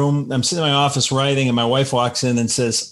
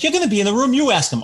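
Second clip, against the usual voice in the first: exasperated retort; 10 semitones higher, much faster than usual. Retold or imitated. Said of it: "I'm like".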